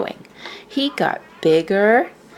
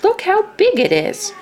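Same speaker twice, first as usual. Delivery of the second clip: much higher, amazed at the size; 14 semitones higher, 3 dB louder than usual. A narrator's reading. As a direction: marvel at the huge egg. Missus Bird marvels at egg.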